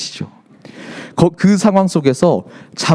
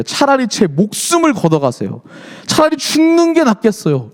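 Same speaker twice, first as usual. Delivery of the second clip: retold desperate ultimatum, rising high; threatening protest; 7 semitones higher than usual. Jonah daring God to take his life, defiant. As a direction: act out a mild dramatic threat. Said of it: "하나님을 막 항변하고 하나님께 협박하기".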